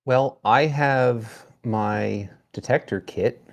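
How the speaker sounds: ordinary delivery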